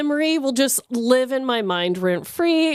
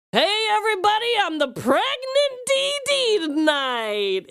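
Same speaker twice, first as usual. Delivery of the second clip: calling out to the bar; 11 semitones higher than usual. Retold or imitated. Announcing to the karaoke bar crowd.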